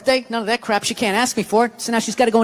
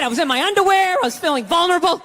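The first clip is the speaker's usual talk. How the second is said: normal talking voice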